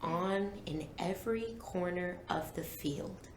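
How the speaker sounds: low, soft storybook narration; story narration. A narrator's reading. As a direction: read the scene slowly and softly, setting the mood. Reads the book aloud.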